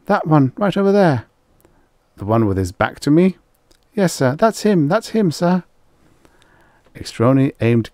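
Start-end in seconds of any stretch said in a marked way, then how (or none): 0.1-5.6 high, loud, rushed blame; frantic accusation. Acted. Rye accuses Mia to Extroni, high and frantic. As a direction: rush it out to save yourself.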